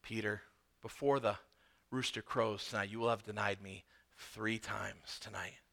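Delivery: Jesus calmly warning Peter, low and soft. Retold or imitated. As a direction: tell it quietly and calmly, like a certain fact. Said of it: "Jesus says to him calmly".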